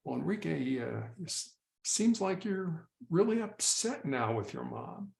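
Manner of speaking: quiet, slowed DJ calm for a ranting caller; late night DJ voice. Shown as a demonstration. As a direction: soothe him like a late night radio host. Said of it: "use that sort of late night D J voice".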